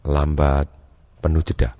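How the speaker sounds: low and softened, full of pauses; unhurried, each word set apart; calm, slow speech. Shown as a demonstration. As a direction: mirror a slow talker calmly, low. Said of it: "kita juga ikutin kalem, lambat".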